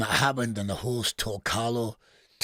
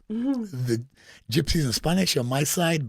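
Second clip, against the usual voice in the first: ordinary talk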